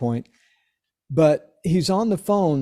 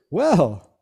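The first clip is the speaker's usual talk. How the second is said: laughing; 7 semitones higher than usual. Retold or imitated. Punchline of Jocko's phone call.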